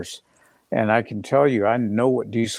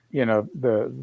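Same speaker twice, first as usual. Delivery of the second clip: ordinary talk, nothing marked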